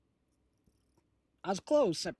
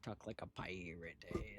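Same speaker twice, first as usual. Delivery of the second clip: whispering; 6 semitones lower, 12 dB quieter than usual. Real, not acted. Whispered search typing.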